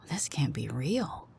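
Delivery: hushed, stunned whisper to herself; slow disbelieving whisper under the breath; muttered. Acted. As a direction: breathe it out in disbelief, barely voiced. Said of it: "I muttered".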